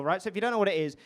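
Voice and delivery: usual speaking voice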